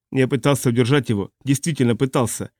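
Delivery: ordinary delivery, nothing marked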